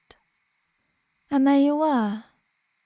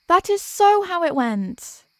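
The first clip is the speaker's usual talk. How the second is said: higher, louder, slow indignant insistence; mock insistent; 7 semitones higher, 5 dB louder than usual. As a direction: insist it happened exactly so. Insisting on his romantic version.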